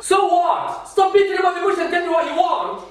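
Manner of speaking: loud, high-pitched angry retort; irritated outburst. Acted. Husband answers his wife's complaint, angry outburst. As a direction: cut in loudly with impatience. Husband snapping in marital quarrel.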